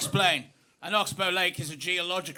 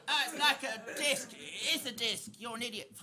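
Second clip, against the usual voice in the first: put-on Cockney children; 3 semitones higher, 8 dB quieter than usual. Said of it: "featured two Cockney children".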